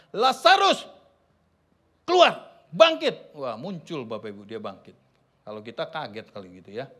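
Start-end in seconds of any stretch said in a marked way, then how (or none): 0.1-3.3 a loud commanding call, slow on the command. Acted. Jesus calling Lazarus out of the tomb with a loud command. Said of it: "Dia berseru dengan suara keras".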